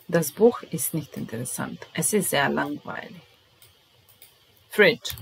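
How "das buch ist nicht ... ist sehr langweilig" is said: usual speaking voice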